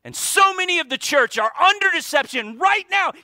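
loud, high, driving preacher's voice; preaching at full force. Acted. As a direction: preach it out to the congregation.

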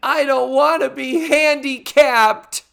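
high, whiny mock sob; mock crying. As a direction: overplay a tearful teen meltdown. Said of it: "she starts crying".

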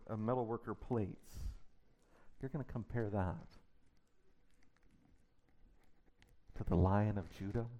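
quietly mock the idol; hushed scornful question; scornful disbelief. God's scornful challenge, softly.

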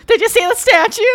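high, loud cheerful mom; chirpy oblivious mother. Retold or imitated. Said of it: "my mom just goes".

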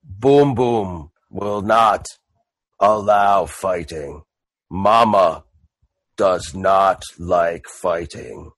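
very slow, low brute; Boom Boom. Acted. Boom Boom forbids fighting, low and very slow. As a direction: lay it down deep and deliberate.

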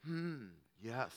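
low, soft hum of a gentle listener; mock calm listener. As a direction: play the patient boyfriend, soft and low. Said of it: "Bob was like".

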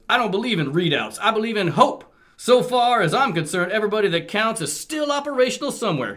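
Blaster voice. Acted. Reading Blaster's hopeful speech, high hammy cartoon voice.

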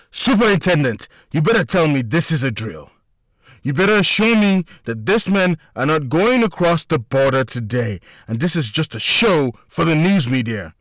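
loud, high furious demand. A narrator's reading. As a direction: demand an answer in fury. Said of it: "Mikael began furiously".

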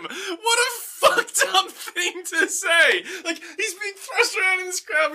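very high, strained disbelief; laughing incredulity. Real, not acted. Host mocks the crowd man's odd line, incredulous and laughing. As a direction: rant while nearly cracking up.

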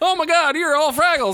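high, excited disbelief; real giddy surprise, pitch way up; delighted squeal. Real, not acted. Laughing reaction to scene.

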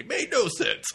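high, loud hammed-up delivery; mock declaiming. Co-host parodying the comic's nonsense dialogue, loud mock declaiming. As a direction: belt it out as a punchline.

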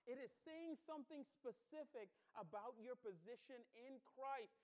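ordinary talk

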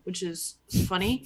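ordinary delivery

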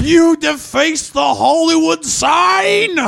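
high, slow mock outrage shout; hammed-up indignant yell at vandals; outraged shout. Acted outraged quote.

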